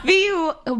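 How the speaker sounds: laughing high through the words. Real, not acted. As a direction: giggle out the start. Laughing through quote retry.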